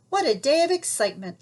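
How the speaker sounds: a touch of laughing relief. Acted. Granny laughing with Little Red after the rescue. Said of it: "laughed Granny".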